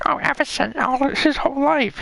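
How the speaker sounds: high-pitched mock mom; high, put-on mother impression. Acted quote of mom.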